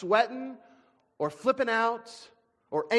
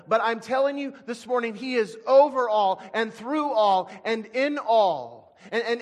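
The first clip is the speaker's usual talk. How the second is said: louder, building sermon delivery; preaching; 4 semitones higher, 6 dB louder than usual. Real, not acted. Preacher insisting to the church that God is sovereign, louder and building. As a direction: drive it home to the congregation.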